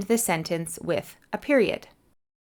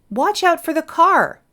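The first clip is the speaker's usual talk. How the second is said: high, loud warning shout; mother yelling; 9 semitones higher, 5 dB louder than usual. Acted. Reading the exercise aloud, mother shouting a warning. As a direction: shout it out to stop someone stepping into the road. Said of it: "my mother yelled".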